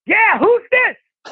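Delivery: very high, slow mock yell; dad's phone holler. Dad answers an unknown caller, high mock yell. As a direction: holler back down the line in a high voice. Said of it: "And my dad goes".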